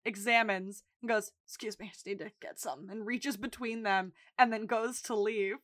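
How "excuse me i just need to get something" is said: quick, soft imitation of a roommate slipping by; unobtrusive interruption. Ron walks in on the two, quick and quiet. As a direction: say it fast and much softer, in passing.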